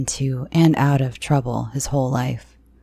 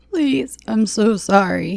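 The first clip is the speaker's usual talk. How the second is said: high, slow crying apology; 7 semitones higher, 3 dB louder than usual. Acted. Brett crying his apology to the girl. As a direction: weep the apology. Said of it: "he cried".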